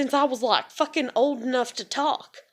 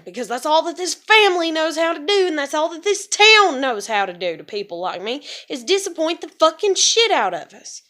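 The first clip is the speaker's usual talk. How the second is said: higher and louder, near shouting; furious rant; 5 semitones higher, 6 dB louder than usual. Real, not acted. Ranting about her family and getting her daughter back, furious. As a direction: let the anger spill over.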